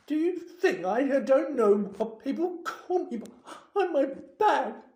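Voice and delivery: high, loud, tearful tirade; self-pitying ghostly howling; anguished wail. A narrator's reading. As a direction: wail each insult, drawing it out. Weeping ghost wails out her nicknames.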